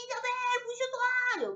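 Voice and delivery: high, loud mock bark of an order; imitating a yelling boss. Retelling her old boss yelling at her, high and loud. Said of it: "il faut arrêter de crier".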